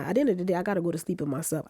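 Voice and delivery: ordinary talk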